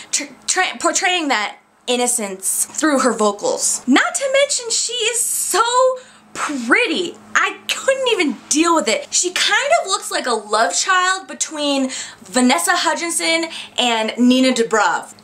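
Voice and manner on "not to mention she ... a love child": high, gushing squeal. Real, not acted. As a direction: swoon over how pretty she is. Gushing over actress's looks.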